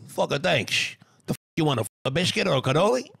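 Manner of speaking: lightly voiced gruff mobster, quick at the end; a hint of a New Jersey mob boss; Tony Soprano impression. Host answers fan praise as Tony Soprano. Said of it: "my favorite impressions ... Tony Soprano".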